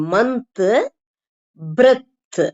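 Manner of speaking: மந்த், பிரெத் each released on a th; final TH. Shown as a demonstration. Teacher drilling th at word end for learners, clearly. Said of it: "முடிவுல வர்ற இத்து sound".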